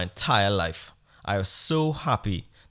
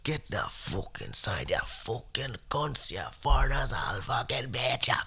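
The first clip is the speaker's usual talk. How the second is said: imitating a menacing neighbor; 4 dB quieter than usual. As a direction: threaten them in a thick put-on voice. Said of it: "He said".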